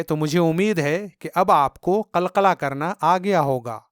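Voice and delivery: usual speaking voice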